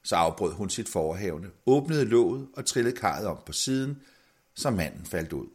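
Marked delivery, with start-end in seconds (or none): none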